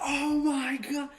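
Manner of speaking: high, slow, drawn-out amazement; awestruck pop. Retold or imitated. Gamer retelling the moment he popped, drawn-out awe. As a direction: stretch it out in awe. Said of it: "the moment when I popped".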